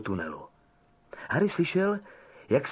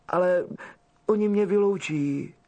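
starting to cry; 5 semitones higher than usual. Acted. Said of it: "rozplakala se Ginny".

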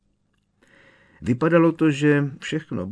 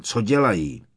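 mildly angry demand, raised pitch; a touch of fury; furious; 4 semitones higher, 4 dB louder than usual. A narrator's reading. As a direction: snap the question crossly. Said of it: "vyjel na něj vztekle".

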